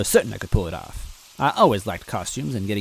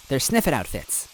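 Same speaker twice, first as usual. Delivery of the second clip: cheerily; 4 semitones higher than usual. A narrator's reading. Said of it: "she said cheerily".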